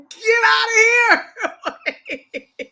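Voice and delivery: loud, high, slow laughing cry; laughing shout. Real, not acted. Wife reacting to her husband saying they eat monkeys, shouting and laughing. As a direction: shriek through laughter.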